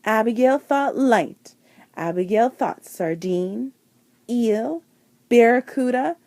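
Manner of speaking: usual speaking voice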